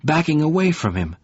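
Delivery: ordinary delivery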